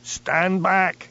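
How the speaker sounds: high, carrying shout of warning; great yell. A narrator's reading. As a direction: bellow it to clear the crowd. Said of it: "yelled Geordie in a great voice".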